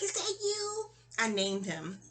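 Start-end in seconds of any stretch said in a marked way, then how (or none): 0.0-0.9 much higher, soft and amazed; high wondering. Retold or imitated. To the bunny she thinks reincarnated, high soft wonder. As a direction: ask it like a child seeing a lost pet. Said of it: "I'm like".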